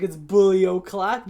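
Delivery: slow, drawn-out catchphrase, punched up; emphatic mock-menacing call; loud taunting announcement. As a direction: proclaim it at Jason, stretching the words. Retelling his taunt to Jason.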